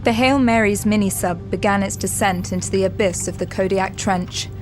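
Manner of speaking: normal talking voice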